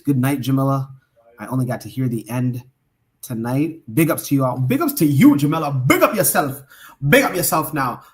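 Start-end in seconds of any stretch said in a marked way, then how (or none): 3.9-8.0 attempted Caribbean accent. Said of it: "my attempted Caribbean accent".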